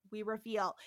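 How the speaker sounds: ordinary delivery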